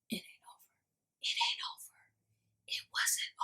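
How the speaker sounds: dramatic suspense whisper. Acted. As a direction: hush it like a spooky twist.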